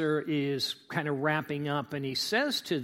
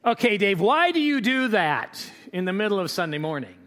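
imagined congregant; 5 semitones higher, 8 dB louder than usual. Retold or imitated. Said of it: "Now you say".

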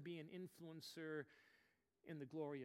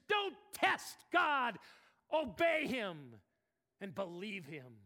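slow, loud, high shout; shouted command; 4 semitones higher, 13 dB louder, slower than usual. Real, not acted. Preacher warns congregation, slow booming shout. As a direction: thunder the warning.

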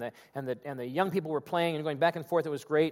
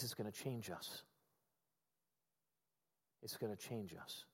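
much softer and lower, sinking; hushed; 7 semitones lower, 17 dB quieter than usual. Real, not acted. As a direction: let it fall to a hush. Solemn repeated point.

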